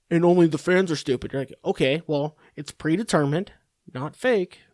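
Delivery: normal talking voice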